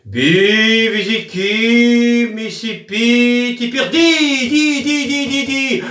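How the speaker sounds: slow, high, drawn-out chanting of nonsense words; playful fake spell chant; mock magic incantation. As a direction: intone it like a hammed-up wizard casting a spell. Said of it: "tu fais".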